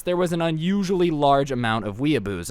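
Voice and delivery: ordinary delivery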